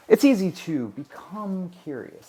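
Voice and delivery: Kubrick-style hypnotic delivery; hypnotic command voice. Shown as a demonstration. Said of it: "talk like that in a very kinda hypnotic way".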